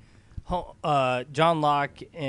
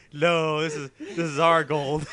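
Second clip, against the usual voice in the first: slow, laughing through a villain line; laughing villain quote; slower than usual. Retold or imitated. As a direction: laugh while quoting the villain. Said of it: "come in and say".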